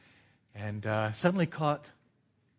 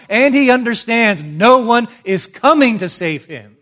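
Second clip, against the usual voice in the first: loud, high, impassioned pulpit cry; full-force preaching; 7 semitones higher, 14 dB louder than usual. Acted. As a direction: hammer each phrase like a fiery preacher.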